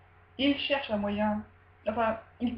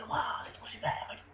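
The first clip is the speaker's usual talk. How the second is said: hushed, whispery mock awe; playing awestruck under the breath; whispered wonder; 2 semitones higher, 7 dB quieter, faster than usual. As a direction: whisper it like an amazed child. Voices the son's wonder at the shell.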